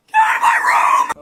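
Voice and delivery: shouting. Acted. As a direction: throw him out with a yell. Mom ends the talk.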